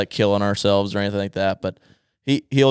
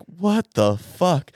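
slow, drawn-out, higher-pitched mock outrage; angry disbelief; 6 semitones higher, much slower than usual. Son imitating dad finding his soaked bag, angry disbelief. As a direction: act out exasperated disbelief, stretching every word. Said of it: "he's like".

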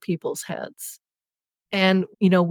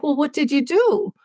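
higher, gently curious question; curious husband; 8 semitones higher than usual. Retold or imitated. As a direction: ask it like the confused husband who wants to know.

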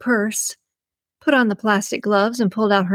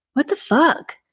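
startled outburst; 7 semitones higher than usual. Acted. Randy grabs a wig.

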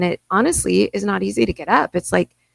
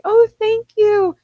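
high, drawn-out mock thank-you; pleasantly surprised; 6 dB louder, slower than usual. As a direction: imitate a surprised, grateful stranger, loud and slow. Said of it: "it just shows how surprised people are".

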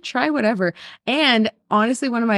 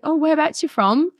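imitated London accent, pitched high; 8 semitones higher than usual. London wedding guest asking a stranger, London accent. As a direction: put on a higher-voiced Londoner. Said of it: "one of her friends is from London".

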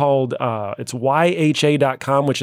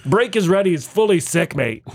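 higher, animated Aussie accent; Australian accent; 5 semitones higher than usual. Shown as a demonstration. Host gives slang in a sentence, Australian accent. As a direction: toss it out as playful Australian slang. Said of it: "See if we can understand some Australian".

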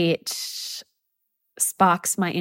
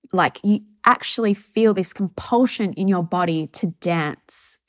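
ordinary talk, nothing marked

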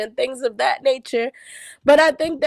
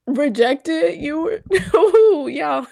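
shaky sigh, close to crying; holding back tears; 2 semitones higher, slower than usual. Real, not acted. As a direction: fight back the tears mid-sentence. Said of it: "I'm trying not to cry".

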